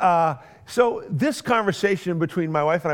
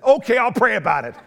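louder, higher "Okay, I'll pray about it" with a laugh, grudging; grudging agreement; 5 semitones higher, 4 dB louder than usual. Shown as a demonstration. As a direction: give in through clenched reluctance. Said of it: "that's really how I said it".

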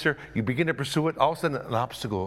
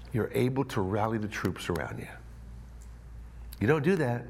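ordinary talk

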